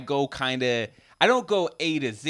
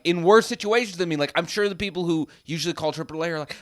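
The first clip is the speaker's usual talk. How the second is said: ordinary talk, nothing marked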